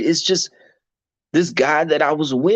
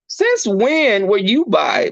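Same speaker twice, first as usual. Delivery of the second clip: sceptical; 8 semitones higher, slower than usual. Retold or imitated. Sceptical reply to the ex.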